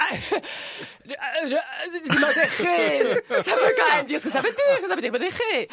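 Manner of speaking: rire gêné, plus aigu et plus fort, très lent au début; nervous laugh. Acted. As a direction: bafouiller en riant nerveusement. Character flustered, laughing nervously. (bourgeoise prise en faute qui rit nerveusement)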